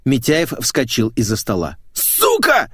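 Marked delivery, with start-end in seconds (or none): 1.9-2.7 very high, loud screamed insult; furious curse. Acted. Boss leaping up at employee, furious shout. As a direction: explode with rage in one word.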